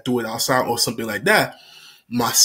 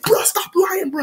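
high annoyed. Retold or imitated. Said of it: "he said".

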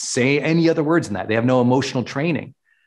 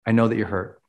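calm reflecting; 5 semitones lower than usual. Shown as a demonstration. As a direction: hold the hurt gently. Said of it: "coach demos a calm reflecting reply".

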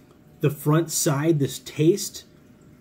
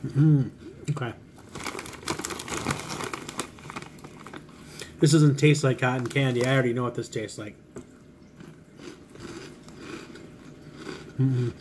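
talking while eating snack; muffled words through food; mouth full, chewing; 4 dB quieter, faster than usual. Real, not acted. Seen 0: puts snack ball in mouth, chews through the words, eats another.

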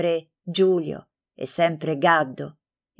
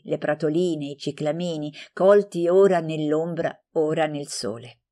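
ordinary delivery

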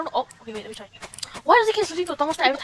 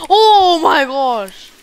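long, loud drawn-out groan; slow dismayed wail; 4 semitones higher, 9 dB louder, much slower than usual. Real, not acted. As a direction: stretch it out in horror. Sanity at three percent.

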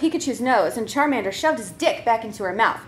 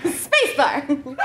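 ordinary delivery

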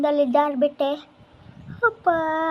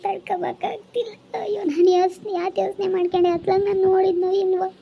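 higher, louder wailing sobs; sobbing in pain; 4 semitones higher, 4 dB louder than usual. Acted. Injured woman blames herself for the fall, higher louder sobbing. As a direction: cry it out, scolding herself through tears. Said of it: "ನೋವು ನೋವು ಅಂತ ಅಳ್ತೈತೆ".

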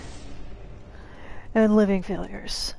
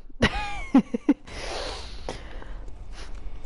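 breathy laugh; 5 dB quieter than usual. Real, not acted. Laughs at herself.

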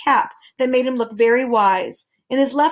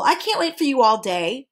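usual speaking voice

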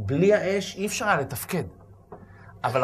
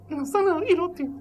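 whiny repeat; 13 semitones higher than usual. Acted. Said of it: "אמר לו".

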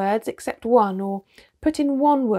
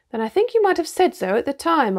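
mild sharp reproach, pitched higher; pardonable sharpness; 6 semitones higher than usual. A narrator's reading. Peter reproaches Susan for not speaking up, with mild sharpness. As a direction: answer back a little sharply, voice lifted. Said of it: "answered Peter with pardonable sharpness".

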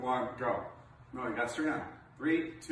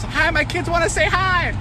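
high mock mom voice; imitating a mom; 14 semitones higher than usual. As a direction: chirp it like a friendly mother. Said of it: "this woman goes".

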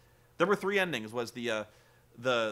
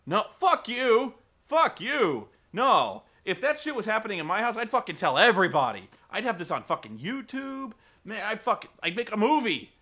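mock angry rant; 6 semitones higher, 6 dB louder than usual. Daughter told to keep quiet.

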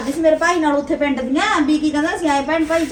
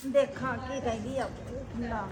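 muttering to herself; 12 dB quieter, slower than usual. Acted. Bride mutters alone, disgusted.

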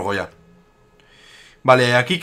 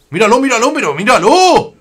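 excited pointing out; 8 semitones higher, 4 dB louder than usual. Real, not acted. Pointing out attack on screen.

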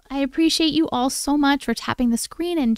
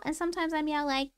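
voicing the cat; 9 semitones higher, 10 dB quieter than usual.

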